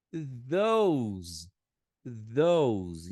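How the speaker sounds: "those" with a voiced th and an "ou" vowel; teacher demo of "those"; English pronunciation. Shown as a demonstration. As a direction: model "those" carefully. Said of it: "aqui você falaria".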